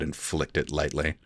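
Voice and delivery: ordinary delivery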